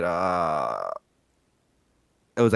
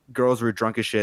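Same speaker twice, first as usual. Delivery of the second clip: normal talking voice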